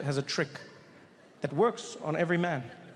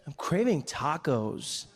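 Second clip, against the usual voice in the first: drawn-out craving; 4 semitones lower, much slower than usual. Retold or imitated. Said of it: "he's like".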